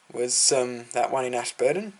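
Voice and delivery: ordinary talk, nothing marked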